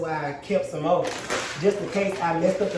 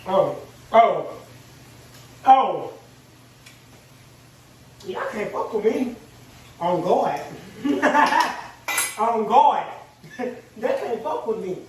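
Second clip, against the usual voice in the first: high, laughing brag with bursts of laughter; cocky laughing brag; excited boast; 4 semitones higher, 4 dB louder, slower than usual. Real, not acted. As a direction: gloat with glee between laughs. Boasting, laughing over his food.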